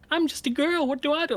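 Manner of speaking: high mocking impression of a helpless princess; mocking helpless girl. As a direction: sneer at her helplessness. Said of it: "she is like".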